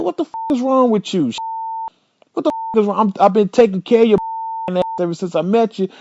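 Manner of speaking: mock fury at her; retold outrage. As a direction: boyfriend half-laughing while yelling at girlfriend. Retold yelling, much higher.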